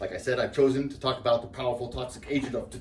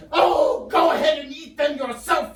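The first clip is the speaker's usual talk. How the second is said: high, loud shouted imitation; wife's angry shriek; 14 semitones higher, 5 dB louder, slower than usual. Wife ordering husband to eat pancakes, angry shriek. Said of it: "And then she said".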